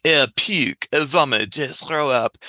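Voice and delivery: hammed-up bad French accent, pitched a little up; mock French accent to make scary words silly; terrible French accent. Shown as a demonstration. Therapist to scared girl, deliberately terrible French accent. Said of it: "I say these words in a terrible French accent".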